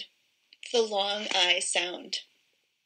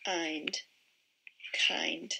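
soft; 5 semitones lower, 9 dB quieter than usual. Shown as a demonstration. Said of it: "Where do you hear the vowel sound".

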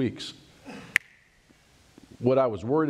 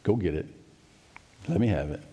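low, quiet fatherly instruction; softening, dropping voice as God offers help; God speaking; 6 semitones lower, 3 dB quieter, faster than usual. Acted. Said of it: "and God says".

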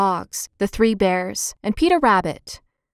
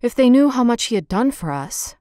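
plain reading voice

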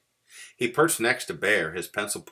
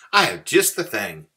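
louder, higher eager mouse; cheerful; 4 semitones higher, 9 dB louder than usual. A narrator's reading. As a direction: announce the perfect remedy brightly. Cheerful mouse offers a remedy.

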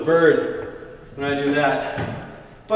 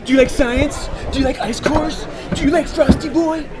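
much higher, louder eager questions, rising each time; hyped pitch; 10 semitones higher, 4 dB louder than usual. Acted. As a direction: sell it like a cheesy ad host.

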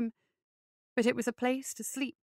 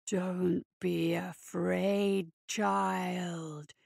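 low, slow, growling spirit voice; 5 semitones lower, much slower than usual. A narrator's reading. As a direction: drawl it like an ancient beast. Voicing December.

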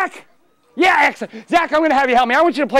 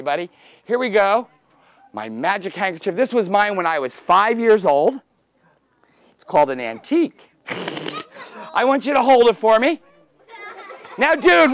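normal talking voice

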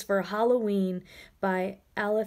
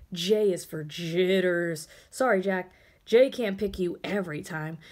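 usual speaking voice

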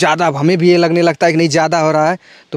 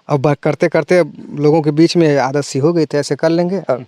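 usual speaking voice

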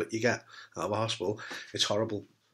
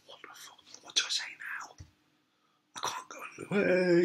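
whispered panicked thought; inner panic; 6 semitones higher, 7 dB quieter than usual. Retold or imitated. Visitor panicking silently, whispered inner voice. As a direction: hush the panic under the breath. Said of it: "You're not going".

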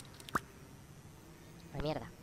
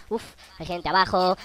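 usual speaking voice

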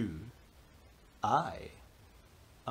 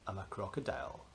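hushed reveal; 8 semitones lower, 10 dB quieter, much faster than usual. Acted. Quiet reveal.

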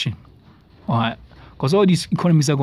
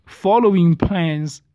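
slow, higher-pitched emphatic read-out; deliberate, drawn-out quoting; 4 semitones higher, much slower than usual. Shown as a demonstration. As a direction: stretch out each word as you read. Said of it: "let me read this thing slow".

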